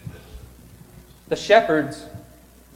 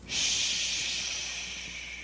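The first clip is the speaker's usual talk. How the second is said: soft hushing sound; shush; 12 dB quieter than usual. Real, not acted. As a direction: hush the room. Hushing the congregation before story.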